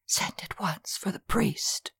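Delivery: faint, soft dying whisper. Acted. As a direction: breathe it out with no voice. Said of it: "except to whisper at daybreak".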